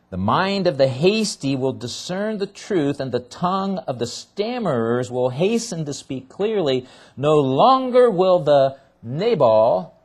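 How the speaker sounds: rising pulpit reading of prophecy; preaching scripture. Acted. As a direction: preach the verse so it rings out. Reads scripture with preaching force.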